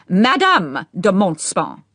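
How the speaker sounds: loud, slow, disapproving correction. A narrator's reading. Old Duchess correcting Boy, loud and disapproving. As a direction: correct him with loud disdain. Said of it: "in a loud and very disapproving voice".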